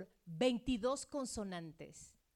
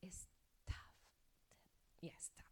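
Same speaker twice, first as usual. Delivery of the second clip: whisper; 3 semitones lower, 15 dB quieter than usual. Acted. Teacher whispers the letter name.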